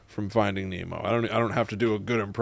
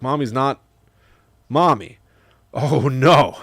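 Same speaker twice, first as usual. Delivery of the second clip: mock-frightened kid, higher and loud; retold terrified child; 4 semitones higher, 8 dB louder, slower than usual. Child frightened by mom's accent, mock-terrified voice. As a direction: cry out in imitated childish alarm. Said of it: "terrified me".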